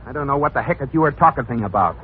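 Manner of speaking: usual speaking voice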